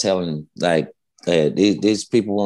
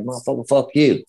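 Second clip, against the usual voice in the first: mild mock anger; 5 semitones higher than usual. Retelling a shouting match with fan.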